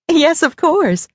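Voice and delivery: high, smiling mother raccoon; cheerfully sure, pitched high. A narrator's reading. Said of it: "she smiled and nodded".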